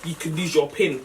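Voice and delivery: ordinary talk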